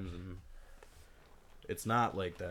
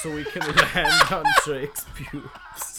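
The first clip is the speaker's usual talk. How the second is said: loud laughing movie-line yell, pitched up; laughing shout; 6 semitones higher, 11 dB louder, slower than usual. Acted. Quoting an action movie to friends, laughing shout. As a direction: bellow it through laughter.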